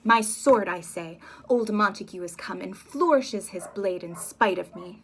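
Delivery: loud insistent snap; loud stubborn demand; angry insistence. Acted. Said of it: "hot-tempered Capulet".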